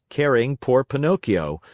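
ordinary delivery, nothing marked

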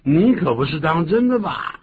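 high, loud incredulous question; astonished comeback; acted disbelief. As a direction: react in amazement to huge news. Acting reply to lottery news.

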